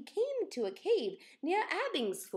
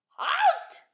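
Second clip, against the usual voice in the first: high, loud commanding shout; loud, high battle command; 11 dB louder than usual. Acted. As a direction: order the beast to stop. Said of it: "shouted Kevin".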